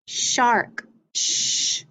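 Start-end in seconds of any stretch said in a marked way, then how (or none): 1.1-1.8 SH as one long voiceless hush; isolated letter sound. Shown as a demonstration. Teacher drilling sound cards, SH hushed and held. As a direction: hold the start of shark, SH. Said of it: "do our letter sound cards now".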